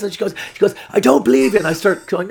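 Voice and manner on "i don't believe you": raised, loud retort; angry girlfriend. Retold or imitated. Girlfriend rejecting the therapist story, angry raised voice. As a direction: imitate her snapping back in disbelief. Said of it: "she's so angry though".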